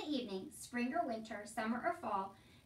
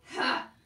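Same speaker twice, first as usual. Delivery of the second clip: single loud "ha" of scorn; scornful laugh; 12 dB louder than usual. A narrator's reading. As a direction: bark out one mocking laugh. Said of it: "laughed Uncle".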